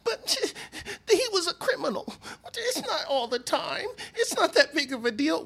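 high mocking voice with a laugh. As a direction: squeak it out like a dismissive bystander. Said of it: "and y'all go".

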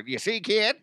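high, hammed-up mustache-twirling villain; Conan O'Brien weird voice. Podcast host taunting the claw-machine kid in a mock villain voice. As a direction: needle the kid like a cartoon villain. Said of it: "He always does that weird voice".

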